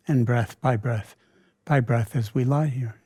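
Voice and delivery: ordinary talk, nothing marked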